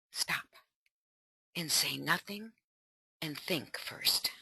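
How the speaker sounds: dropping to a near whisper; softly, almost whispering, think first; quiet whispered advice; hushed. Shown as a demonstration. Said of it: "demonstrating saying nothing".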